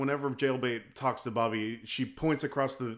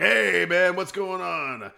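higher, louder drawn-out hail; hearty greeting; 5 semitones higher, 5 dB louder, slower than usual. Retold or imitated. Bijou slaps Bobby on the back, hearty greeting. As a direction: call out a big retold hello.

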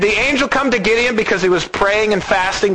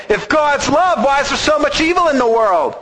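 mocking complaint; 8 semitones higher than usual. Voicing lost people's complaint.

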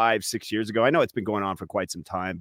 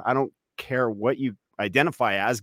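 ordinary talk, nothing marked

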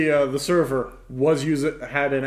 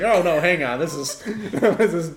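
laughing mock switch; 3 semitones higher, 3 dB louder than usual. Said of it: "it's like".

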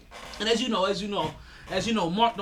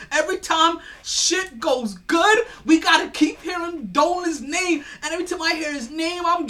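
exasperated rant; 6 semitones higher, 4 dB louder than usual. Real, not acted. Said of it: "I gotta keep hearing this man's name".

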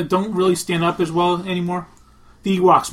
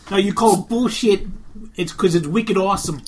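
put-on Boston accent. Co-host defending wicked awesome accent, Boston accent. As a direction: lay on a thick Boston accent. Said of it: "just do the accents again".

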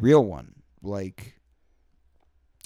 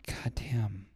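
whispered God damn; 6 dB quieter than usual. Real, not acted. Exhausted exclamation after stress.

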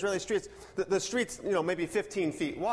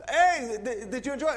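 higher-pitched local calling over; man across street; 5 semitones higher, 4 dB louder than usual. Retold or imitated. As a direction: hail departing visitors in a raised voice. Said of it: "the man across the street goes".

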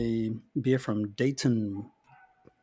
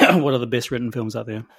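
talking through coughs while choking on beer; coughing; faster than usual. Real, not acted. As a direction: keep reading as a cough breaks in. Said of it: "choking on both beer".

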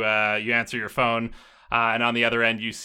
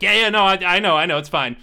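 louder, higher, hurried placating reply; brushing her off; 7 semitones higher, 6 dB louder than usual. Acted. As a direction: wave off someone nagging behind you. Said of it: "And he's like".

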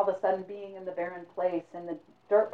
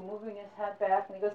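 ordinary delivery, nothing marked

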